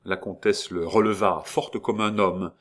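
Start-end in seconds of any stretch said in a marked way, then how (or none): none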